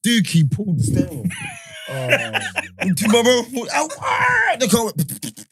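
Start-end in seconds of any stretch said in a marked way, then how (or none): 3.7-4.6 sudden high ow ah; retold cry of pain up high; high mock yelp. Car crash cry retold.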